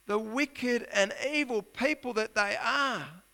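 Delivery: a prophet pressing God for answers; voicing Habakkuk's complaint. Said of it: "what Habakkuk is saying here is".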